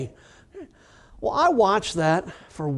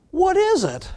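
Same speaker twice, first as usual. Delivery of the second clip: naive question; 14 semitones higher than usual. Retold or imitated. Naive rookie asking.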